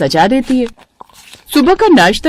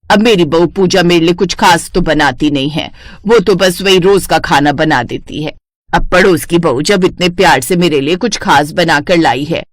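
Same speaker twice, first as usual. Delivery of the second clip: put-on lower, older mother-in-law voice, sweetly fond; 3 semitones lower than usual. Mother-in-law fussing over the neighbour's halwa, lower voice. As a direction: play the doting mother-in-law, a lower aged voice.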